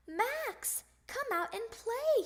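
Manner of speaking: high singsong call; hollering. Acted. Froggy at Max's house, high holler. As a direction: call a friend out from the yard. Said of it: "he hollered".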